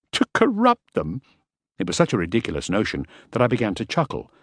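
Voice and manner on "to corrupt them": high, drawn-out disbelieving question; slow, squeaky-high astonishment; incredulous. A narrator's reading. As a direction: echo the charge as utterly ridiculous. Incredulous reply to ridiculous charge.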